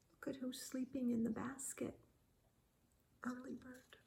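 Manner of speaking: fast hushed whisper so the bird stays asleep; whisper, don't wake her; soft whisper aside. Acted. Aside to listener about sleeper.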